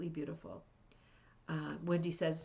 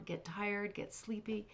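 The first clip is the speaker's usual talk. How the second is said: ordinary delivery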